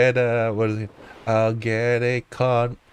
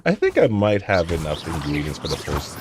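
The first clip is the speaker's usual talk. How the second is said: ordinary talk, nothing marked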